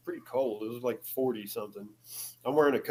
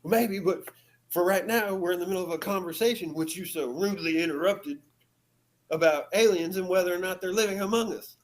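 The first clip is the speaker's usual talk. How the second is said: higher, louder mock snippy retort; snapping; 6 semitones higher, 3 dB louder than usual. Companion snapping at rude girl, mild mock snippy tone. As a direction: put her in her place. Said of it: "snaps at her".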